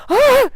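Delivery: shrill high shriek; scream of fright. Retold or imitated. She shrieks as the spider stares at her.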